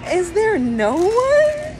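high, slow whimpering plea; despairing wail. Real, not acted. Begging for one worthy partner, wailing. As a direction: wail it, stretching the last word.

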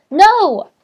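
high, loud protest; desperate shouted refusal; horrified cry. A narrator's reading. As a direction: cry out against the threat. Hero protests threat to friends.

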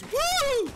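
very high, loud woo; celebratory whoop. Real, not acted. Streamer whooping at a blackjack win, elated. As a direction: whoop it out in triumph.

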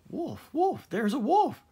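high, loud panicked cry. A narrator's reading. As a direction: yell it while scrambling up a tree. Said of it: "the boy cried".

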